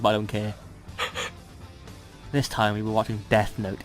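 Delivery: normal talking voice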